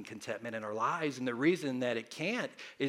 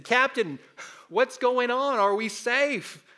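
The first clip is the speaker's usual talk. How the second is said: worried question; 10 semitones higher, 5 dB louder, slower than usual. Retold or imitated. Frightened sailor asks the captain.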